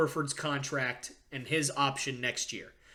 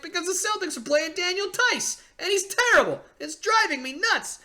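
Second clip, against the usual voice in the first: higher, fast exasperated rant; frustrated rant; 13 semitones higher, faster than usual. Retold or imitated. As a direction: vent like a fed-up fan at his wit's end. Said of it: "Sorry about the rant".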